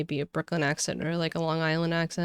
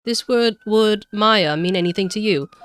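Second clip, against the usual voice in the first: louder, higher Cockney questioning; attempted Cockney accent; 5 semitones higher, 8 dB louder, slower than usual. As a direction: press the witness in makeshift Cockney. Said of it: "Cockney, Cockney, Cockney".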